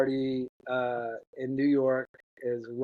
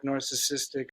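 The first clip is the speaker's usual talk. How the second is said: usual speaking voice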